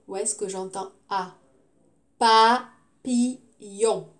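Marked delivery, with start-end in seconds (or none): 2.2-4.1 "Papillon" very slow, pa - pi - yon; syllable by syllable. Shown as a demonstration. Teacher repeats for pupils, slowly syllable by syllable. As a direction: leave a gap after each syllable of "Papillon".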